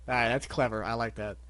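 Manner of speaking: usual speaking voice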